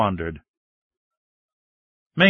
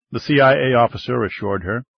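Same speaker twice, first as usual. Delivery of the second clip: usual speaking voice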